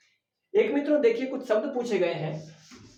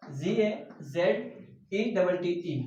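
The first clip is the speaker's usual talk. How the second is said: spelled out slowly; much slower than usual. Shown as a demonstration. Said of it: "spelling the word out letter by letter".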